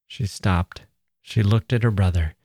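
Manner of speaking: gentle storytelling read aloud; story narration. A narrator's reading.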